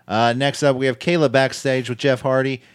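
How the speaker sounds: ordinary talk, nothing marked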